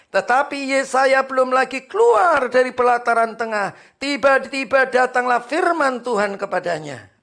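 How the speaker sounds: loud, high scripture reading; full-force preaching. Acted. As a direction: proclaim it to the congregation. (membaca ayat dengan suara khotbah lantang)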